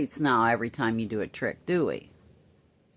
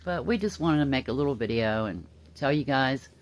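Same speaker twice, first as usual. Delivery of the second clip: normal talking voice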